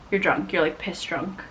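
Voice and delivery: ordinary delivery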